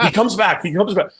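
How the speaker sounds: high, loud mock excitement; excited prankster. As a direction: call it out like a thrilling replay. Acting out the prankster's excited showing.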